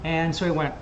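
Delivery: ordinary talk, nothing marked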